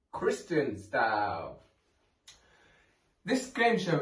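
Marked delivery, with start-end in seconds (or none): 0.1-1.6 dramatic announcer voice. Acted. Game show title announced dramatically.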